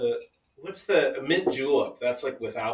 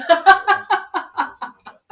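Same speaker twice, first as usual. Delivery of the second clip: helpless laughing admission; 9 dB louder, slower than usual. Real, not acted. Owning up to a botched Southern accent, laughing. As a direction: say it through real laughter, higher pitched.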